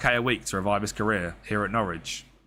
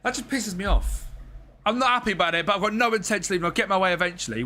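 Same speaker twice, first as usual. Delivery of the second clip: sulky unhappy player; 8 semitones higher, faster than usual. Acted. Voicing an unhappy player's complaint.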